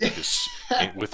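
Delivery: words caught in a laugh. Real, not acted. Breaks up laughing mid-sentence.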